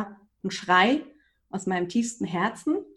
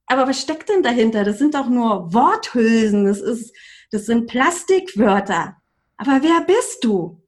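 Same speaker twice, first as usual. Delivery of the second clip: challenging inner voice; 3 semitones higher, 7 dB louder than usual. Retold or imitated. Coach's inner voice confronts her about empty words, challenging. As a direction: press the question home, loud and insistent.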